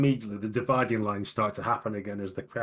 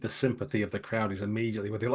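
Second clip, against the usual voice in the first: ordinary delivery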